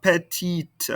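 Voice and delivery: "Petite" with a clearly released final T; sounded final T. Shown as a demonstration. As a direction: close "Petite" on an audible T. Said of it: "pronounce this ending consonant".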